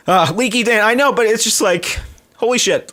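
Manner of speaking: choked up, fighting back tears over a big tip; real emotion close to crying, pitched high; emotional, near tears. Real, not acted. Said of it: "I'm gonna get emotional now".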